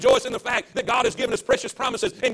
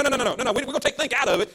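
normal talking voice

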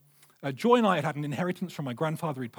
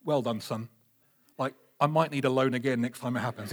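lightly voiced scheming dad; 6 semitones lower than usual. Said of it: "do you think I was like".

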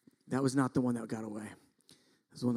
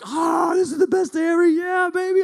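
high, loud shout of triumph; so excited; 9 dB louder than usual. Retold or imitated. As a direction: re-enact a wild celebration. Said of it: "I'm so excited".

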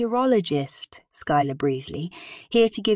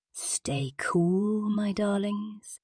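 slow breathy whispered goodbye; whispering; 7 dB quieter, much slower than usual. Acted. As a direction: whisper it close to the mic. Sultry sign-off.

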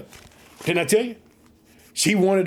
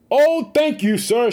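higher, louder joyful thanks; exclaimed thanks; 6 semitones higher, 3 dB louder, slower than usual. Acted. Preacher voicing Hannah thanking the priest, joyful exclaim. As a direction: burst out grateful and relieved. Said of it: "she exclaimed".

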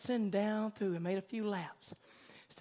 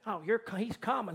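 usual speaking voice

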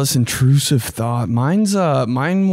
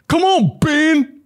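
much higher mock coach shout; imitating coach's yell; 3 dB louder, slower than usual. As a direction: bark it like a screaming coach. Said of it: "basketball coach ... would rip me to shreds".

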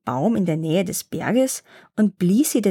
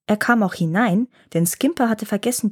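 ordinary delivery, nothing marked

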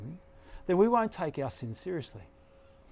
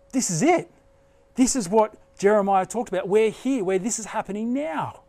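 excited realization; 4 semitones higher, 5 dB louder than usual. Retold or imitated. Daniel's excited realization quoted.

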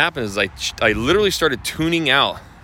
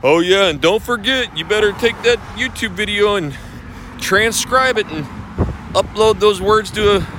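mocking the Clubhouse guy; 7 semitones higher than usual. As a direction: ham up the smug advice. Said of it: "And he's like".

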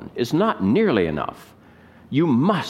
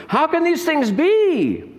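high, slow astonished question; baffled Nicodemus; 3 dB louder, slower than usual. Retold or imitated. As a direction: sound truly bewildered.

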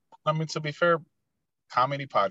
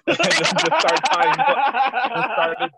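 laughing through the words, high and slow; can't stop laughing while telling it; cracking up mid-story; 11 semitones higher, 6 dB louder, much slower than usual. Real, not acted.

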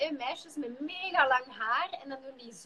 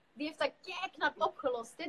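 ordinary talk, nothing marked

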